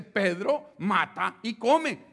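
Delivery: God's booming order to Pedro. Acted. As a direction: draw out each word like God. God repeats the command.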